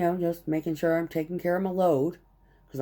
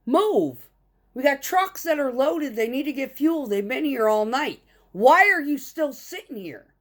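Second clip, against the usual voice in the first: much higher and louder, scolding; retold yelling; 10 semitones higher, 5 dB louder than usual. Yard kid orders the loafing driver to move, yelling. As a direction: shout him out of the fuel island.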